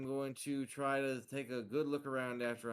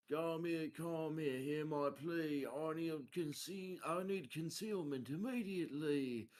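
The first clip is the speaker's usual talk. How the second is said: higher, slow drawn-out verse; rhymed incantation; 4 semitones higher than usual. Acted. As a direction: intone a rhyming plea for concealment. Recites a rhymed plea in character.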